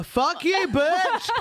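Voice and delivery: high, hammed-up heckle; comic aggressive jeer; mock abusive shout. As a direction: yell it at a rival runner in jest. Acts out abusing runners she passes.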